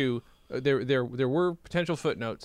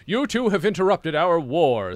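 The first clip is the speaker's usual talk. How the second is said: high, loud royal proclamation; big comic monarch voice; Cat King; 5 semitones higher, 7 dB louder than usual. A narrator's reading. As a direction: declare it as an offended king. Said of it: "said the king".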